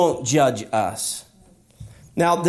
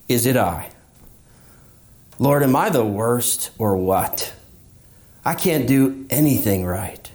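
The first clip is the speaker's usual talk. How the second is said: low, defeated pleading to the Lord; a hint of a believer giving up; despairing believer; 6 semitones lower than usual. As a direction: let the voice sink as if ready to quit.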